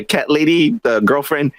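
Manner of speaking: ordinary delivery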